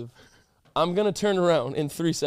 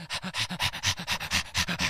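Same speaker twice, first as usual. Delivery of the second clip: quick, voiceless dog pants; dog panting; 7 dB quieter than usual. Shown as a demonstration. Host demonstrates to cohost how dogs pant, rapid breathy panting. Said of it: "panting is like them cooling them".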